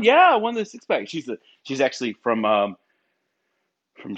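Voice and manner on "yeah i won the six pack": excited coworker. Retold or imitated. Coworker voiced in story.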